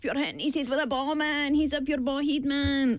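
mock a Glasgow street lad in a Scottish accent; Glasgow ned accent. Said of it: "something that I would expect to hear in a scheme in Glasgow".

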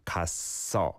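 갔어 with ss running into the second syllable; 갔어 as gas-sseo, linked; blended double siot. Shown as a demonstration. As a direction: carry the tense s of 갔어 over into eo. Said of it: "then blends over to the second one".

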